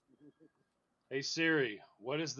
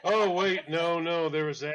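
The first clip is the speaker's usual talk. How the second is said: surprised; 5 semitones higher, 11 dB louder than usual. Real, not acted. Sudden discovery.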